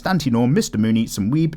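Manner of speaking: normal talking voice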